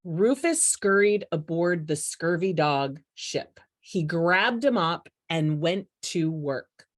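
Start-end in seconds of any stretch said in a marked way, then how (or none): none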